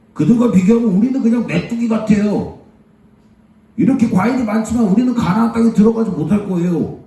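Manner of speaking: high-pitched, acted dismay; worried spies' report. Spies warning the people about giants, high-voiced dismay. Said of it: "또 이야기를 하기를 어떻게 얘기를 했느냐?".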